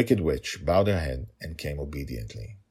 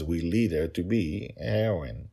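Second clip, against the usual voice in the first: ordinary talk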